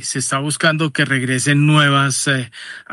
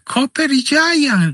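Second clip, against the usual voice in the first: slow, higher, drawn-out English name in Pereira accent; Pereiran-accented English; 11 semitones higher, much slower than usual. Shown as a demonstration. Host teasing language purists who scolded him, Pereira-accented English. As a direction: stretch out the English words, Pereira-style. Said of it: "no les gusta mi inglés pereirano".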